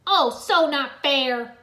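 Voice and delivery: loud, slow whine; Jay watching the rescue, loud slow whine; exclaimed. A narrator's reading. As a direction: stretch it out in envy. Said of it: "Jay exclaimed".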